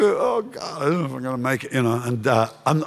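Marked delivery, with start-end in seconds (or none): 0.0-1.7 despairing, near tears. Retold or imitated. Pastor pouring out despair to his friend at lunch, quiet and weepy. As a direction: lower and softer, a mild weepy murmur. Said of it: "I'm talking like this".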